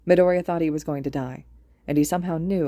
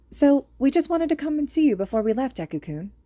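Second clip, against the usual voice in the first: put-on young girl voice; 9 semitones higher than usual. A narrator's reading.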